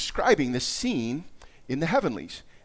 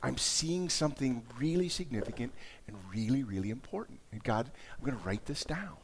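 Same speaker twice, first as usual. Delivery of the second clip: hushed awe; 2 semitones lower, 7 dB quieter than usual. Acted. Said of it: "put yourself in his headspace".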